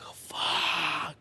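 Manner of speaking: whispered "Oh, fuck" in retold dread; dismayed whisper. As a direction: breathe it out under your breath, caught. Hears parents will be called.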